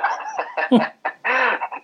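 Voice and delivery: high breathy laugh at himself; real amused laugh; burst of laughter. Real, not acted. Laughs at his own boast.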